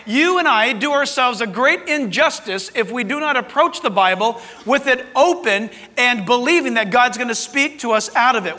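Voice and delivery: ordinary talk, nothing marked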